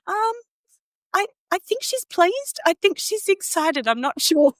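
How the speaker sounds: high, slow, puzzled mum voice; her mum, unsure. Retold or imitated. Mum filming the birth, unsure what she sees, high and slow. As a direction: imitate a bewildered mum, pitched well up and slow. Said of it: "hear my mum on the video go".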